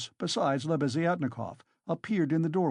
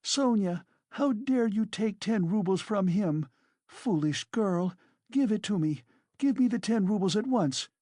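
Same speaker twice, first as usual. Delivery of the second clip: frantic scolding; 8 semitones higher than usual. A narrator's reading. Katerina scolds Sonia frantically.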